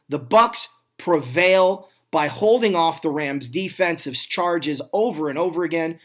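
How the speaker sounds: usual speaking voice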